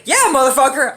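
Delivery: ordinary talk, nothing marked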